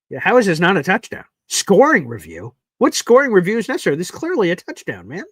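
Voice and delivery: exasperated. Real, not acted. Fan disputes touchdown review.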